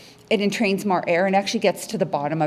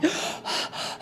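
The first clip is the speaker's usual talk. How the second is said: sharp airy gasp for breath; demonstration gasp; 8 dB quieter than usual. Shown as a demonstration. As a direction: gasp hard like you just ran out of air. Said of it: "and then".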